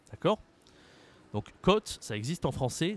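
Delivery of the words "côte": côte with an open O, like "cotte"; no circumflex. Shown as a demonstration. Teacher names the unaccented word, open O in côte. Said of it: "ce n'est pas du tout la même chose".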